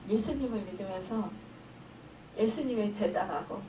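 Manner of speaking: usual speaking voice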